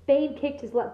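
ordinary delivery, nothing marked

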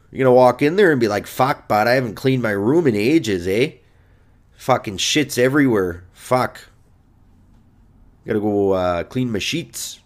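put-on Canadian grumbling; Canadian accent. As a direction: moan about the mess in Canadian. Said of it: "I'm doing Canadian".

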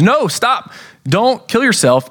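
mild mock yell to stop him; Paul yelling out. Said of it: "Paul yells out".

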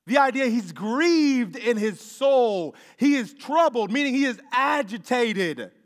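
loud, high preaching cry; full-force preaching. Acted. Preacher urging the congregation on the delay, loud preaching. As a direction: preach it out to the whole room.

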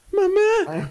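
put-on high childlike wail; retelling a scared boy on the coaster; scared kid calling mom. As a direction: cry out for mom like a frightened boy. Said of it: "escucho el morro".

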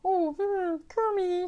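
high puppet diva voice; Miss Piggy. Acted. Clerk actor slipping into Muppet voice, high. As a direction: coo the name in a high put-on voice. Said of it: "you're, now you're doing a miss, Piggy".

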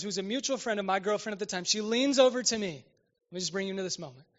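ordinary delivery

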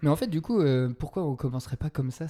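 ordinary delivery

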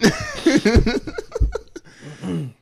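shrieking high laugh; loud high laugh. Real, not acted. Big laugh.

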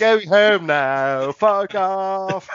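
slowly drawn-out go home; slow dismissive. Acted. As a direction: spell it out, dragging. Dinosaur voiced, slow.

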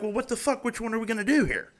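high, mock-baffled bureaucrat voice; mocking insurance people. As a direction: squeak it out like a clueless clerk. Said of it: "So they're like".

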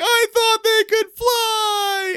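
very high, drawn-out fake wail; mock wailing Kelly. Kelly watches her turkey fall to his death, slow high mock wail. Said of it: "And she's like".

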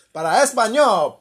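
loud, high recorded prompt voice; phone menu voice. Pretend. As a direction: announce it like an automated hotline. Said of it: "For Spanish, press one".

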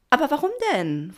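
high, loud, puzzled question; eager young dolphin. A narrator's reading. Pina wants to know why, in a high voice. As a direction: protest with youthful eagerness.

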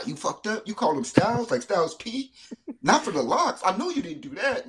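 high, mock-outraged callout; imagined scolding fan. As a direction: play the offended rapper fan. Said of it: "a Rick gonna get on my helmet".

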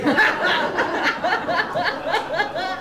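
very high cackling laugh; high giggle. Real, not acted. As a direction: crack up at your own pun. Laughs at own joke.

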